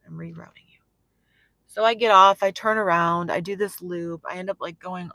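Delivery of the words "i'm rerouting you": hushed whisper as God's voice; barely-there whispered redirection; whispered divine rerouting. Retold or imitated. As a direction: whisper it as a patient correction. Voicing the Lord's repeated redirection.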